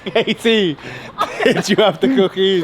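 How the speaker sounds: loud playful call; yelling across the room. Real, not acted. Calling across to Katie.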